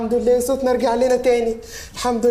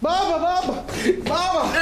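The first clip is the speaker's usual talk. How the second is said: laughing; 5 semitones higher, much slower than usual. Acted. Son reunites with father.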